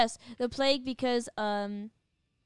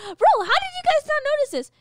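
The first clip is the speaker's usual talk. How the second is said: excited disbelief; 13 semitones higher, 6 dB louder than usual. Real, not acted. Shocked at missed nursery-rhyme meaning.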